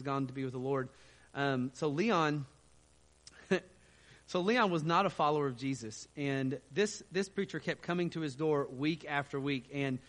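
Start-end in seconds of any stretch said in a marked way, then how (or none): none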